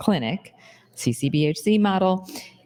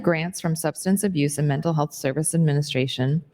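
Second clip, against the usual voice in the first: ordinary delivery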